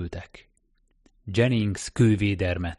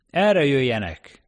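loud, high, drawn-out call; 6 semitones higher, 6 dB louder, slower than usual. A narrator's reading. Foreman steering workers to the railway, calling out. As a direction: call the crew over slowly and loudly.

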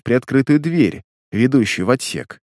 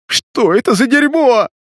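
very high panicked scream; 12 semitones higher, 4 dB louder than usual. A narrator's reading. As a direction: shriek in terror. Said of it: "завопил Тон". (испуганный вопль)

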